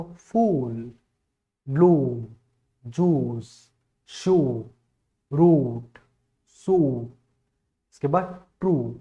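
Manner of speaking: फूल and जूस with a long drawn oo; long oo demo. Shown as a demonstration. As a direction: hold the oo in फूल. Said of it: "बड़ा ऊ".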